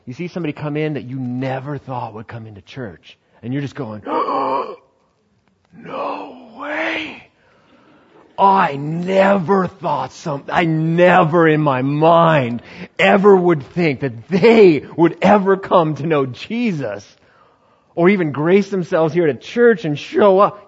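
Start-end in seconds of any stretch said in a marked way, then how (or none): 4.1-4.7 sharp high gasp of disbelief; shocked gasp. Acted. Churchgoer spotting a newcomer at the door, shocked gasp. 5.7-7.3 high, crying-out no way; stunned disbelief. Acted. Churchgoer seeing an unlikely visitor, high shocked cry. Churchgoer sees newcomer at door. 8.4-20.7 higher, louder, slow and marvelling; incredulous. Acted. Churchgoer marvelling that the newcomer came, loud incredulous gush.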